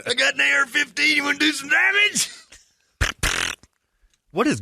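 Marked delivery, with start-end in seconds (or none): 0.1-2.3 squeaky menacing mock; mock gun guy. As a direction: ham up the eager offer. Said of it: "mock gun guy offer".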